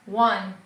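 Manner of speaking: "one" said like the number, standing for won't. Shown as a demonstration. Teacher showing how won't sounds, said like "one". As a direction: pronounce "one" exactly like the English numeral. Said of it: "pronúncia é muito parecida com o número um".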